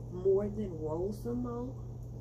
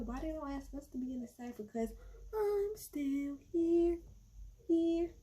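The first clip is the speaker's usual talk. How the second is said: mocking Tashina; 6 semitones higher than usual. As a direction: stretch out "I'm still here" to tease. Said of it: "She was like".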